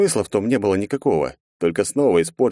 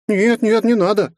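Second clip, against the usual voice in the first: put-on high, flustered girl pleading; startled child, quick no; alarmed little girl; 5 dB louder, slower than usual. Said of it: "всполошилась она".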